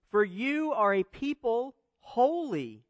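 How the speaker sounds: "holy" drawn out and set apart; slow the line down and lean hard on "holy"; stress on holy. Shown as a demonstration. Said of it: "I'll put some emphasis on the word".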